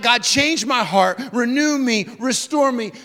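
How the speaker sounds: loud pleading prayer; desperate cry to be renewed. Acted. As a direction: beg God with urgency. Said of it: "He's crying out".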